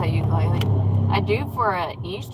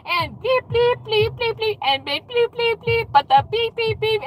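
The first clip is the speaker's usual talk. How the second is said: robotic bleeping; 13 semitones higher than usual. Retold or imitated. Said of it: "and it was like".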